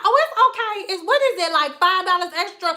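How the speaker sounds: breezy client. Retold or imitated. Client voiced, laughing.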